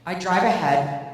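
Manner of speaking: usual speaking voice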